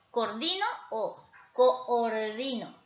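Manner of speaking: "coordino" said slowly, first as co-or-di-no, then run together; split vs joined vowels. Shown as a demonstration. Teacher contrasting two ways of saying "coordino". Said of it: "suena mal decir yo coordino".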